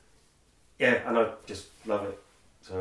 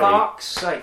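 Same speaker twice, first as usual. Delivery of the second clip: exasperated; 11 semitones higher, 6 dB louder than usual. Real, not acted. Cannot recall the actor's name.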